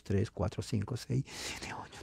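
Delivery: counting drops away to a whisper; soft whispered tally; fading whisper. Real, not acted. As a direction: let the count fade to breath.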